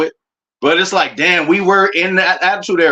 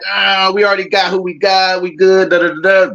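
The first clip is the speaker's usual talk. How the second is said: mock smug fans; 3 semitones higher than usual. Mocking complacent Bears fans.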